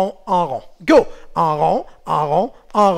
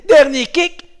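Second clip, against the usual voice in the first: loud, higher call of last kick; 8 semitones higher, 8 dB louder, slower than usual. Shown as a demonstration. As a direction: punch out dernier kick. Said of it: "tu dis meilleur kick".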